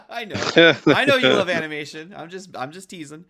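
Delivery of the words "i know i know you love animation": loud, high, laughing reassurance. Real, not acted. Host teasing co-host about animation, laughing loudly.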